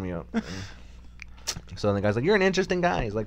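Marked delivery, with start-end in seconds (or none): 2.2-3.0 put-on high little-boy voice; baby talk. Retelling the little king's words to Goku, babyish voice. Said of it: "He talks like a baby".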